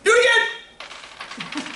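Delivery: very high, drawn-out slow yell; high, eager shout; excited yelling. Real, not acted. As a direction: call it out slowly, voice way up. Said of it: "Sorry, I'm yelling".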